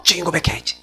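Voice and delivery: soft mutter to oneself; murmured self-reminder. Acted. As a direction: drop to a quick, quiet mental note. Listener murmurs a resolve to self. (작게 혼잣말하듯)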